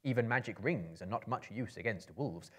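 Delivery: ordinary delivery, nothing marked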